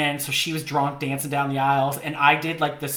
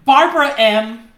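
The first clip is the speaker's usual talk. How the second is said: loud, high, drawn-out mock introduction; showing off a headshot; 7 semitones higher, 8 dB louder than usual. Agency employee pitching a headshot, mock announcement. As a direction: announce the name big and slow. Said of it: "be able to be like".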